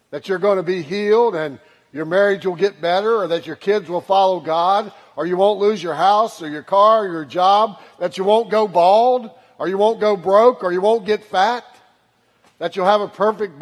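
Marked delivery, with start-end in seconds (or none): none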